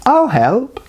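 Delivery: tiny high tinkling voice; tiny green girl offering help, high and tinkling. A narrator's reading. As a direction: chime it like a little bell. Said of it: "somebody tinkled".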